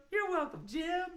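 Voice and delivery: high little head-voice reply; high-pitched echo. Pretend. Echoing for the children answering the dog, high voice. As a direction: answer in a small, high sing-song.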